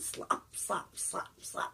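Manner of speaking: hushed, unvoiced slops; wet slopping sound. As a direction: imitate soggy footsteps, barely above a whisper. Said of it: "like a wet sound".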